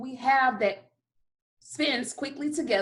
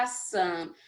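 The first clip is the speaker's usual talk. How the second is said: ordinary talk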